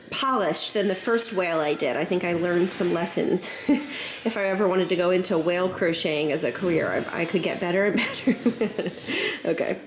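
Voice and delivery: usual speaking voice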